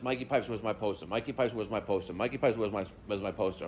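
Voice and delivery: a low, quiet mock of a nagging fan; mock customer whine. Customers' emails mocked in whiny voice.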